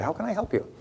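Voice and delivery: put-on high-pitched, polite Italian-accented waiter. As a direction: greet softly with a hint of an Italian accent. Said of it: "he had an Italian accent".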